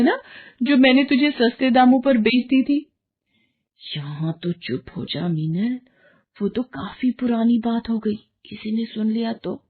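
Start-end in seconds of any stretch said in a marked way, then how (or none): 4.0-9.6 hushed plea. Acted. Hushing friend at party, embarrassed.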